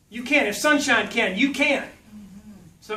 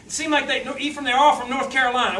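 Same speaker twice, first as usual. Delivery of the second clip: usual speaking voice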